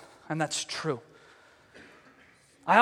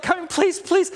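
higher, urgent begging; mock pleading; 7 semitones higher, slower than usual. Haman comes close begging the queen, mock pleading. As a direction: act out a panicked plea. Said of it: "Freaking out".

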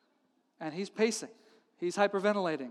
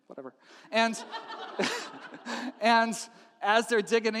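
normal talking voice

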